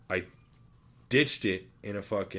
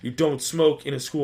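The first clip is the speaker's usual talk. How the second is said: higher, louder stern scolding; mock stern principal; 5 semitones higher, 7 dB louder than usual. Assistant principal tells him off for smoking, raised mock-stern voice. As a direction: lay down the rule to the kid.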